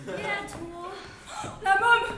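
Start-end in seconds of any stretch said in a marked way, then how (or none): 1.6-2.1 loud, cheerful toast calls; hearty toast. Acted. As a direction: raise a glass and call out. Toasting each other with nicknames. (toast enjoué et sonore)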